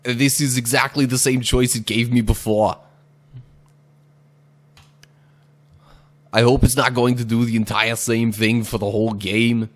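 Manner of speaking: loud hammed-up Indian accent; lightly put-on Indian accent. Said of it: "that last one was my attempt at doing, like, an Indian accent".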